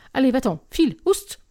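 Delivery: high, urgent shooing cry; shooing away. A narrator's reading. Narrator tries scaring a menacing dog away, shooing it sharply. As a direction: drive the animal off, pitch leaping on the last word. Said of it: "dans le but de l'effrayer et de le renvoyer".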